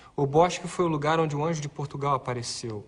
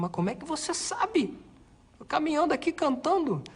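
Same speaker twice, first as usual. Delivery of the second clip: high, surprised question; 12 semitones higher than usual. Retold or imitated. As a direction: ask it in disbelief, pitched up. Retold surprised reply to friar.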